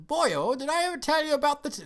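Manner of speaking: put-on high, loud Scottish accent; David Tennant. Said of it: "this is my bad David Tennant Scottish impression".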